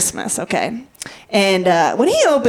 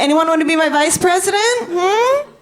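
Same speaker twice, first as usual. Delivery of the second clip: pleading crowd work; 7 semitones higher than usual. Acted. Asking crowd for running mate.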